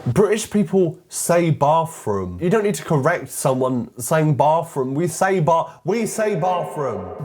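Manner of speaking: high-pitched, emphatic protest; exasperated insistence. Real, not acted. Exasperated rebuttal to film's correction.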